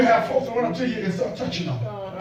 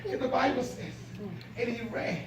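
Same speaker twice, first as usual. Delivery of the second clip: much softer, intimate sermon; hushed preaching; 6 dB quieter than usual. Acted. Pastor softly telling how the father ran to his son.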